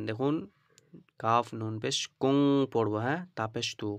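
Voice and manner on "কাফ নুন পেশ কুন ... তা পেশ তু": normal talking voice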